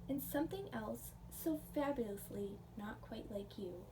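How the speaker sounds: plain reading voice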